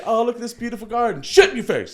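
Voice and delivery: high laughing mock cheer. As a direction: act it out while cracking up. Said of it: "acted garden victim".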